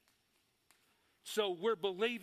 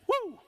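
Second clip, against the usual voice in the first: high, loud whoop; excited whoop; 14 semitones higher, 7 dB louder than usual. Real, not acted. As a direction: let out a sudden high woo. Whooping while preaching.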